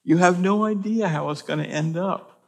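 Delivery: high, urgent preaching line; emphatic exhortation. Acted. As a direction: drive it home to the church. Exhorting congregation.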